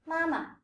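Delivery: "Mama" high first syllable, lighter short second; neutral second syllable. Shown as a demonstration. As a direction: let the second half of "Mama" fall away short. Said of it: "which is lighter and shorter".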